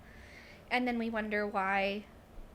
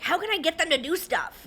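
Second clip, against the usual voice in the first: a higher, louder exasperated plea; exasperated parent; 6 semitones higher, 7 dB louder than usual. Retold or imitated. A parent asks how to get kids to do stuff, exasperated. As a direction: throw up your hands like a worn-out parent.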